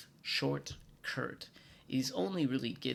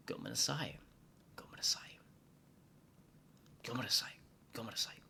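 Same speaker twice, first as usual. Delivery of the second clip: "Gomenasai" over and over, growing softer; bow and keep apologizing, quieter each time; flustered waiter apologizing, fading away; fading repeated apology; 4 dB quieter, faster than usual. Acted. Chef apologizing repeatedly to customer.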